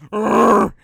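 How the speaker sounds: loud imitated bear growl. Imitating the bear about to chase the actor, growling. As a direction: roll out one rough growl. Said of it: "and then the bear goes".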